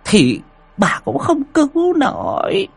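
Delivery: put-on high, sharp old woman scolding. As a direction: hush her fiercely, in fear. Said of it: "mắng".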